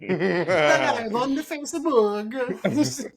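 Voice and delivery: hammed-up Italian accent, high and slow. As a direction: stretch it out in mock Italian. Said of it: "that's my Italian accent".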